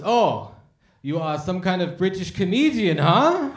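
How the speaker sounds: mock the cop's amused challenge; hammed-up cop; German cop. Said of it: "the cop goes".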